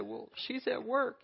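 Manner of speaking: put-on high, slow small-boy voice; little boy. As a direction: answer like a nine-year-old. Boy answers operator.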